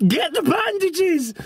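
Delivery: very high, crying shout for help; shrieking for a cousin in panic, slowing on the bandages; panicked shouting. Retold or imitated. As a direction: scream her name in terror. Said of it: "And I'm shouting".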